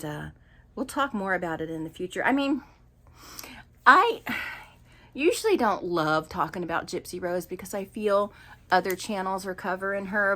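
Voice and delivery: normal talking voice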